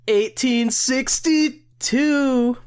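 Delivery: high, slow, drawn-out announcement; sing-song slow reveal; mock reveal. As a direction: stretch each part of the year up high.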